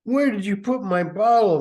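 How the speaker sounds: high, loud, put-on drunk mother voice; shrill the demand like a drunk mom; hammed-up drunk mother demanding; mother demanding her bottle. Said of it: "Missus Haynes said".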